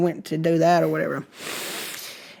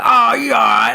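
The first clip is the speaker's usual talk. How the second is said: gruff shout of dismissal, put-on voice; imitated pa; 2 semitones higher than usual. Pa looks at the swollen injury, brushing it off. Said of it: "he's like".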